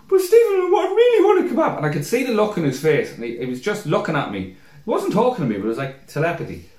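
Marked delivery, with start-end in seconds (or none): 0.1-1.8 put-on high, tearful drunk whine; hammed-up sobby begging; mock crying. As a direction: wail it pleadingly to your mate. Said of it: "I cried for a kebab".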